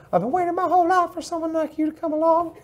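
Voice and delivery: put-on high, soft creepy-old-man voice; creepy old dude. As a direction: croon it like a creepy old admirer. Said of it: "that creepy old dude from Family Guy".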